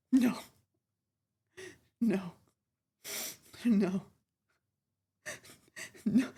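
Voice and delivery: normal talking voice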